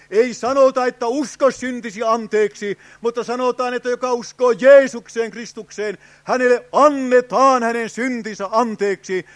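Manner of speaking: loud, ringing revival preacher, every line driven; a revivalist raising the voice over the congregation; fervent preaching. Acted. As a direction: proclaim it to the whole tent with full force. Full-force preaching climax.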